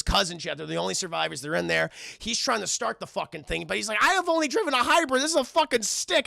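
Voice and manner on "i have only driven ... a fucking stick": fast, high, loud protest; flustered driver in a hurry; mock panic. Max panics trying to start snowplow.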